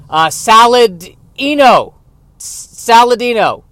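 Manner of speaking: loud, stammering mobster bluff, names blurted high; fake mafia boss. Impostor naming himself to mafia bosses, loud flustered bluffing. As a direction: bluff like a mobster making up a name on the spot. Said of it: "trying to pretend to be a mafia boss".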